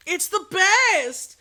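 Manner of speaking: loud, high, drawn-out gushing; excited exclamation. Retold or imitated. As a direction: stretch it out in acted rapture. Retold raving to neighbor.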